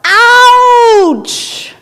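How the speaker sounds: loud high yelp of pain; a child shouting ouch in the woods; child's yell. Acted. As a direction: yell it out like a hurt kid. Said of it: "the child yells out".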